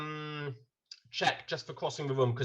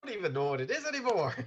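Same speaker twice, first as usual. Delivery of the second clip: mock Irish; 5 semitones higher, faster than usual. GM laughing in Irish voice.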